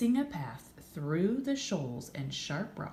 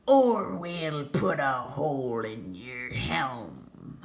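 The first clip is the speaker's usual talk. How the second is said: slow, loud pirate roar; pirate captain bellowing; 7 dB louder, slower than usual. A narrator's reading. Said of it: "the pirate captain bellowed".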